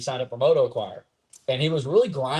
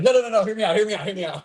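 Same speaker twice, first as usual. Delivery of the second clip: excited pleading; 7 semitones higher, 3 dB louder, faster than usual. Shown as a demonstration. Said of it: "I'm trying to think of what I would say".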